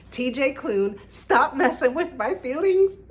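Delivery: high, loud mock protest at the author; excited outcry. Real, not acted. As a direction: cry out in delighted complaint. Calls out to the author.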